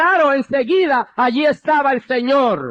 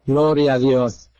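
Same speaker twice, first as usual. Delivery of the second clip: very low-pitched, reverent praise; subdued praise; 11 semitones lower than usual. Real, not acted. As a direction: praise God deep down in your register. Low praise after retold surrender. (alabanza contenida, en tono muy grave)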